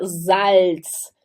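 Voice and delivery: Salz begun with a buzzing z, "zalts". Shown as a demonstration. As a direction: model Salz with voiced z and sounded L. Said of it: "O S se pronuncia com som de Z". (Salz dito "zalts")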